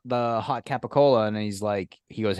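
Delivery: usual speaking voice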